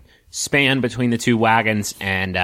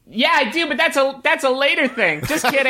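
very high character voice; squeaky, joking in-character reply; Hurley. Acted. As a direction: pitch it way up, kidding along.

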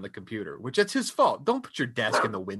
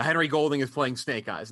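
ordinary delivery

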